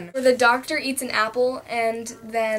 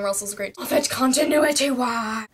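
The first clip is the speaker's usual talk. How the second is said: usual speaking voice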